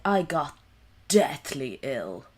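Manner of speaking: Boston Kennedy impression, loud and slow; booming Kennedy-style delivery; Kennedy accent. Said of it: "go all Kennedy on it".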